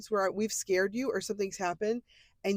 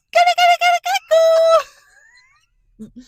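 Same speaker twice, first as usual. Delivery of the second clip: very high, loud hype chant; cheering; 13 dB louder, much faster than usual. Real, not acted. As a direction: egg her on like a cheerleader. Cheering on friend's text.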